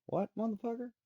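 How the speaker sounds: high, incredulous what-the-hell; indignant outburst. Real, not acted. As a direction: pitch jumps way up in an indignant yelp. Angry retort to critics.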